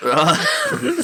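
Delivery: high laughing right. Real, not acted. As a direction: laugh through the word. Laughing burst.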